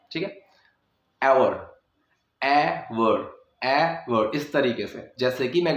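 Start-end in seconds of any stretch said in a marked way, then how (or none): 1.2-4.3 एवर with an open ए start; hour as एवर. Shown as a demonstration. Teacher drilling hour with the ए sound. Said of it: "अ की मतलब ए".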